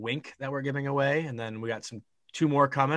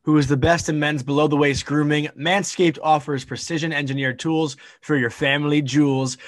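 ad read; 8 dB louder than usual. Acted. Podcast host opening ad read.

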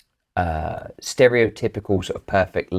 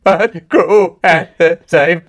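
slow, high, loud wail; hammed-up sobbing lament, words dragged out; mock weeping; 12 semitones higher, 10 dB louder, much slower than usual. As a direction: bawl it slowly in mock despair. Repeating the mock weepy wail.

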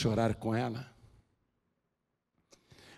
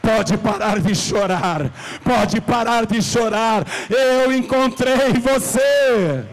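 higher, louder and slow, building to a peak; rising preaching cry; 10 semitones higher, 6 dB louder, slower than usual. Acted. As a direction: lift it into a soaring cry. (clamor crescente de pregação)

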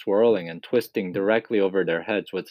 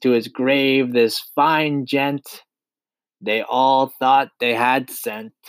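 high, slow, drawn-out triumphant chant; exultant centipede; 5 semitones higher, slower than usual. A narrator's reading. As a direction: declaim it like a gleeful verse, stretching each line. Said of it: "cried the centipede".

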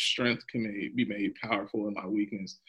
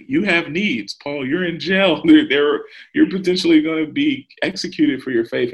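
higher, louder, incredulous imitation of worried friends; mock-urgent pleading, pitched up and loud; alarmed protest; 5 semitones higher, 10 dB louder than usual. Friends reminding Paul he's in jail, alarmed protest.

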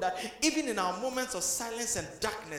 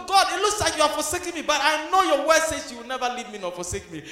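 ordinary delivery

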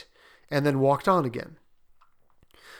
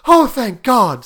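loud, higher, slow outcry; fervent relief; 9 semitones higher, 13 dB louder, slower than usual. A narrator's reading. Mother hearing her son feels no pain, crying out in relief. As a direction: pour out relief to heaven.

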